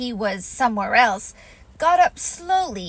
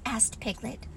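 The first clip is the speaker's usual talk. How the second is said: ordinary delivery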